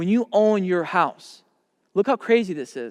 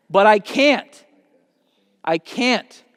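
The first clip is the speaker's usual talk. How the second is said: higher and louder, cut off hard; frustrated outburst; 4 semitones higher, 6 dB louder, much slower than usual. Real, not acted. Mentor venting about his own lost time, loud. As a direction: let the frustration burst out.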